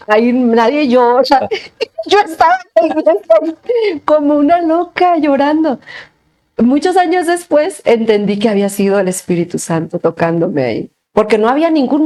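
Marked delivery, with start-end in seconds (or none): 0.1-7.8 high, loose laughing delivery; amused at her own embarrassment, words breaking into laughs. Real, not acted. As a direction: tell it through bursts of laughter.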